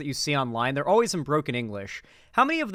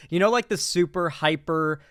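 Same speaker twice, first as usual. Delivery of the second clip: ordinary talk, nothing marked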